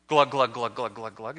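low, soft gurgling glugs fading out; drowning glug. Retold or imitated. Swimmer going under after only believing, soft glugging. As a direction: sink the words like water closing over.

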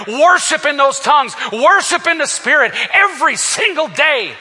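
high, loud shouted exhortation; full-force preaching. Acted. Preacher shouting at the congregation to let the Spirit fly. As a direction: shout it out to the church.

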